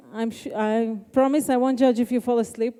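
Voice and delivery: normal talking voice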